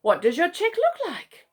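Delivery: a gentle question from a big kangaroo; curious kangaroo. Acted. Bluey the kangaroo asks.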